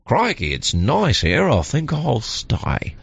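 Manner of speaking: slow, lightly put-on Australian accent. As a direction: drawl a hint of Australian accent. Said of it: "Their accent changed just ever so slightly".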